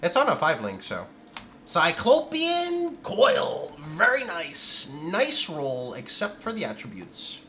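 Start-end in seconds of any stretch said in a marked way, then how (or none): 1.6-4.9 very slow, higher drawn-out name; savouring. Real, not acted. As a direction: relish each syllable of Cyclopean. Savouring a good item drop.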